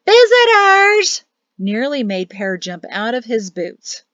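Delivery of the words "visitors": high, drawn-out holler; loud, high-pitched announcing yell. A narrator's reading. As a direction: yell it down the hall to wake them. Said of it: "The yell of".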